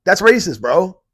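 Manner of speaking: loud callout. Pretend. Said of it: "I would be like".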